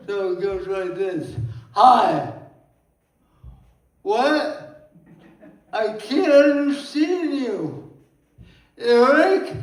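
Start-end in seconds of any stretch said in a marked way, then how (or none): 1.7-2.3 loud, punchy acted hello; acted greeting. Poem acts out a first meeting. 4.0-9.5 higher, louder puzzled listener; confused stranger. Acted. Acting confused stranger guessing his name.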